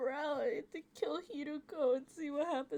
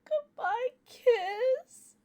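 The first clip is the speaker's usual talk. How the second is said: tearful wail; 11 semitones higher, 11 dB louder than usual. Real, not acted. Gamer crying over the goodbye kiss scene. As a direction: wail it out, heartbroken.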